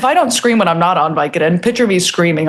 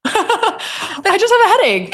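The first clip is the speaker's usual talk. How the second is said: laughing; 11 semitones higher, slower than usual. Real, not acted. Laughing through line.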